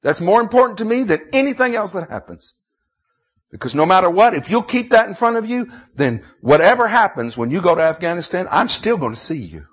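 loud, high Southern pulpit preaching; full-force sermon delivery; impassioned preaching. Real, not acted. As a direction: preach it out high and hard, leaning on key words.

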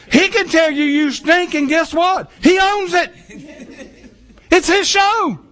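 emphatic preaching. Real, not acted. Emphatic preaching about Simon.

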